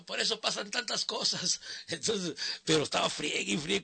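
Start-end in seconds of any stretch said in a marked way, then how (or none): 0.0-1.6 put-on higher drunken catchphrase; drunk heckler. Drunk fan repeating the catchphrase, higher. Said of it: "decía".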